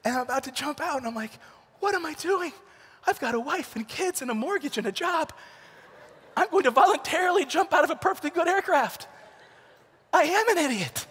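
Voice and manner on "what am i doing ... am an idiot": high-pitched mock freak-out; hammed-up terrified jumper, building to a cry; comic panic. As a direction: plead with yourself in rising, retold panic. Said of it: "I'm so, so afraid".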